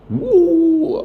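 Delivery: high, loud howling cry like a lion; imitated lion cry. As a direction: let out a loud rising howl like a lion. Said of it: "Yacinthe a crié encore comme un, un lion".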